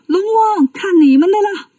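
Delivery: urgent call upward. Acted. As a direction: shout up to the dragon kings for help. Wukong calls to dragon kings.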